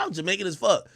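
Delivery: normal talking voice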